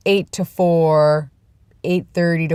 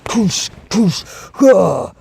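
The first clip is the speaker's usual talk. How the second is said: punchy puffed muscle-flex noises, louder and higher; Popeye; 3 semitones higher, 5 dB louder, much slower than usual. Retold or imitated. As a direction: imitate a muscleman with loud bursts of "poosh". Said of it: "he reminds me of, like, Popeye".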